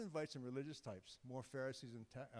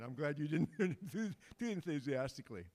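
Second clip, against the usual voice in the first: louder, laughing through the words; laughing tease; 3 semitones higher, 8 dB louder than usual. Real, not acted. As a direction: chuckle while teasing the crowd. Teasing congregation about weak amen.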